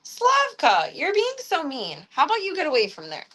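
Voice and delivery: a squeaky telling-off for the cat; high mock scold. Real, not acted.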